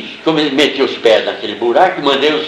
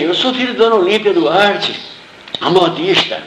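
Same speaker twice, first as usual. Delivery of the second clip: higher, imitating a terrified boy blurting his name; a scared kid pleading through tears, mock-replayed; boy caught under the wall, answering the soldier in fright; retold crying boy; 7 semitones higher than usual. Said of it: "E gritando de lá".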